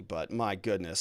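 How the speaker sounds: normal talking voice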